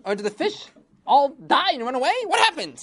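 high, loud scolding king; high shouting king rising to a demand; angry king. Acted. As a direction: berate the minister like a furious king.

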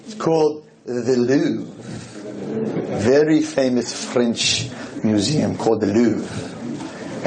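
a slow, bad French accent that drifts off; fake French accent. Rabbi telling the audience about the Louvre in a bad French accent. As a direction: lay on a so-so French accent, slowly. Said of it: "my French accent is not French at all".

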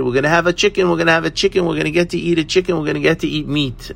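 high, breathless-paced chant of retold glee; excited children. Children tell their mother they'll eat chicken, excited. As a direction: imitate kids tumbling over each other with excitement. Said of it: "the children were so excited".

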